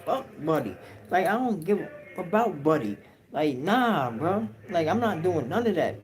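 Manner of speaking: put-on high, defiant young voice refusing; lightly voiced young inmate, scared but refusing; young boy protesting. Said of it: "the young boy like".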